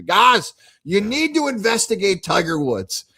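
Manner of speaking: insistent barfly pitch, up high; imitating pub rant.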